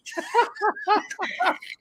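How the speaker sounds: high, helpless giggling; long high-pitched real laugh. Real, not acted. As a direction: crack up at the memory. Recalling yesterday's funny accents.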